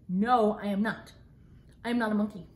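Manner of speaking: plain reading voice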